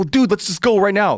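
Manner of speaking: high, urgent plea to go; retold exasperated urging; acted urgency. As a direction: press a dawdling friend to leave.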